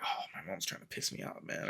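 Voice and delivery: low, very soft grumble under the breath; hushed, half-whispered mock complaint; mock annoyed muttering. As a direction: grumble privately, barely voiced, imitating your own irritation. Acts out own annoyed reaction.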